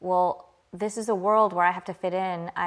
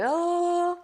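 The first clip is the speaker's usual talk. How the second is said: high, drawn-out "oh"; long held high note of mistaken understanding; mock dawning realization; 13 semitones higher than usual. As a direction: imitate their clueless "ohh", pitched way up. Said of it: "And they were like".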